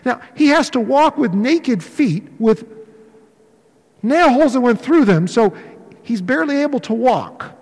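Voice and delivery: ordinary talk, nothing marked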